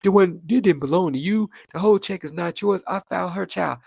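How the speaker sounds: mock-offended ex; imitating ex. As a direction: play the huffy ex claiming the check. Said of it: "gonna talk about".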